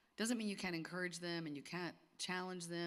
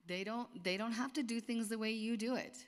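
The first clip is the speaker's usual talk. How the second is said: usual speaking voice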